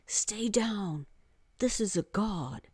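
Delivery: hushed, whispery warning; awed near-whisper; small voice. A narrator's reading. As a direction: say it small, under the breath. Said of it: "said in a small voice".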